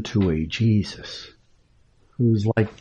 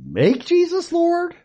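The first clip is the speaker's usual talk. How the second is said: much higher, mocking take on Make Jesus Lord; the mistaken phrasing, high and mocking; mocking wrong version. Shown as a demonstration. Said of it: "words surely matter".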